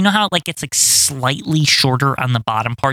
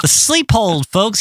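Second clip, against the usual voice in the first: higher mock commentator call; imitating wrestling announcer; 5 semitones higher, slower than usual. Co-host mimics the announcer addressing the folks, mock announcer call. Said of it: "I've heard him say this".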